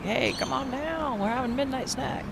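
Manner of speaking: put-on hinted parent inviting the kid to a snack; parent's text. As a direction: call the kid down, lightly acted. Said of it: "send up a text saying".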